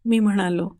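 ordinary delivery, nothing marked

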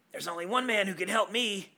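host reads the letter's cliffhanger as Marty McFly. Acted. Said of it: "in your best Marty McFly impression".

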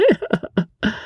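high silly dandy's laugh; foppish laugh. Acted. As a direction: laugh at your own joke. Fop laughs at own joke.

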